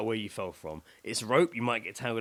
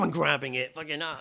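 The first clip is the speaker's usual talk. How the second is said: usual speaking voice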